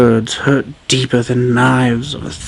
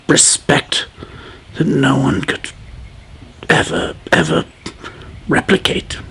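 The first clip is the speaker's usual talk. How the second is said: hushed, breathy trailing words; whispered narration; 6 dB quieter than usual. A narrator's reading. Narrator whispering the king's exit. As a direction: let it fade to a whisper.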